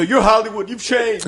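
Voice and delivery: loud, high-pitched mock heckle; high indignant holler; yelling out a window. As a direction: imitate someone hollering an accusation from a car. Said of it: "he's, like, yelling out the window".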